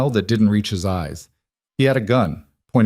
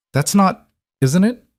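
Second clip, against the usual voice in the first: shocked protest; 10 semitones higher, 4 dB louder than usual. A narrator's reading. Arya cut off, shocked protest.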